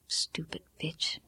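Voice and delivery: slow, soft bitter mutter; muttered curse. A narrator's reading. Daughter muttering at absent mother, bitter. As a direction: curse her under the breath.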